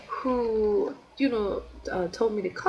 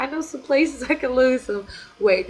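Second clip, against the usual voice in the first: laughing; 5 semitones higher, 6 dB louder than usual. Real, not acted. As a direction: laugh through the words. Laughs at own weight joke.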